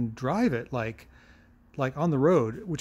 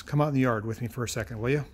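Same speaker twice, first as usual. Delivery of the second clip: usual speaking voice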